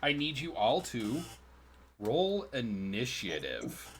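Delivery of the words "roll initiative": slow, dramatic call to roll; drawn-out DM call. Acted. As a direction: stretch it like a game master's announcement. DM calls for initiative.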